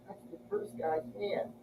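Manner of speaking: hushed whisper to himself; whispered thought. Retold or imitated. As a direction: whisper it like a secret realization. Retelling thought on spotting van.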